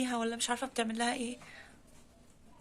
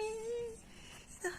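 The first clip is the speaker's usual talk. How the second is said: astonished; 9 semitones higher, 10 dB quieter, much slower than usual. Real, not acted. Sees an odd kitten.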